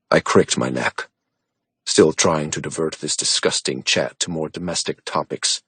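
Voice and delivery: usual speaking voice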